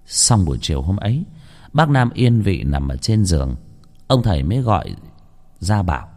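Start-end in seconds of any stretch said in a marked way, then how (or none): none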